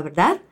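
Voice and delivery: rising question tune on "¿verdad?"; question rise. Shown as a demonstration. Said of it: "repetir lo que ha dicho la persona como una pregunta".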